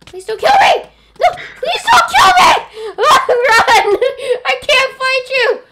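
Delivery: high panicked pleading, half laughing; terrified horror victim. Pretend. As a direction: shriek for mercy like a horror victim. Begging attacker, laughing panic.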